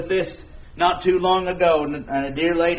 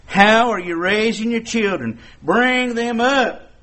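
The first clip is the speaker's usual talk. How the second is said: raised, ringing sermon delivery; preaching at full force; 4 semitones higher, 4 dB louder than usual. Acted. Preacher challenging parents about raising children, raised and ringing. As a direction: press the congregation hard, louder and higher.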